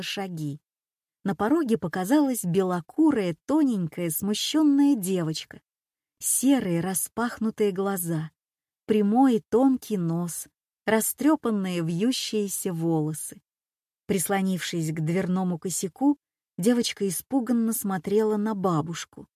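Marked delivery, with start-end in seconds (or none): none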